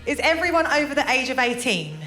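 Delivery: ordinary talk, nothing marked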